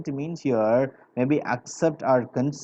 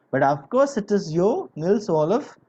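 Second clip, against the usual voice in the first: raised, higher voice for a shouted greeting; mock shout; 6 semitones higher, 5 dB louder than usual. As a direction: call out louder in delighted recognition, as if quoting. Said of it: "he shouted".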